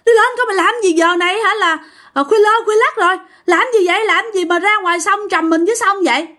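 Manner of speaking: high, fast frightened yelling; rushed high alarm at the river; shocked scolding. Retold or imitated. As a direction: shout at her out of fright. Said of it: "Mọi người mới hết hồn".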